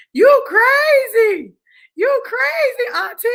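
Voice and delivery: very high, drawn-out squeal; hammed-up incredulous cry; retold disbelief. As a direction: imitate an astonished nephew, stretching every word. Acting out nephew's shocked reaction.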